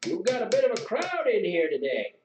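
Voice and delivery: put-on high, lively old-timer voice; old regular at the inn, hearty. A narrator's reading. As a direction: greet the room as a chatty old local.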